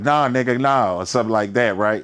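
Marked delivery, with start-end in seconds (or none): 0.0-1.0 deep Southern driver. Retold or imitated. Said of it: "a deep ass Southern voice".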